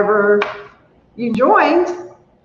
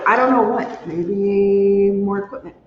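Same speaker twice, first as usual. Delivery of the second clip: normal talking voice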